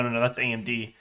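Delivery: usual speaking voice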